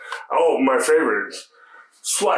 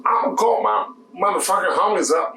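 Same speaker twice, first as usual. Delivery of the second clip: imitating a rude customer; 8 semitones higher than usual.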